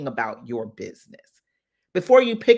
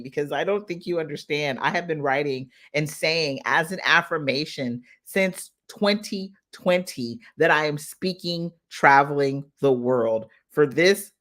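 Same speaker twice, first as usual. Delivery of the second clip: ordinary talk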